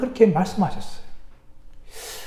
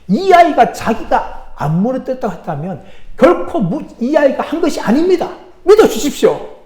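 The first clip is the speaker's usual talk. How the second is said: higher-pitched, emphatic pleading; earnest insistence; 6 semitones higher, 4 dB louder than usual. Acted. Sunday school teacher defends the boy to the pastor, insistent. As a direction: vouch hard, voice rising and getting louder. Teacher vouches for accused child.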